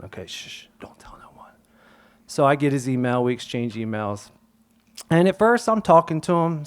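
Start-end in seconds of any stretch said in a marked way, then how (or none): 0.0-1.5 dropping to a whisper, shh shh; secret whisper. Retold or imitated. Teacher slipping his email to the kid, whispering. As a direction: hush it like sharing contraband.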